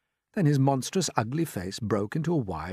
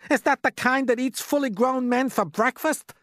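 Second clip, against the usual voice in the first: high-pitched, alarmed asking; terrified question; 14 semitones higher, 4 dB louder than usual. A narrator's reading. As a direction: ask it in rising panic.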